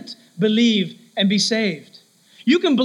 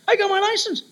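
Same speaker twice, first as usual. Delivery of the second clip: put-on much higher, bright teen voice; a teenager boasting to a parent; proud teenager; 10 semitones higher than usual. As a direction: show off the new license.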